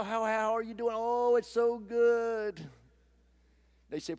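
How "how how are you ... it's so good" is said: higher and louder, the last words drawn out slowly and sweetly; fake churchy cheer. Churchgoer hiding a rough morning behind a fake happy reply. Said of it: "you put on that holy smile".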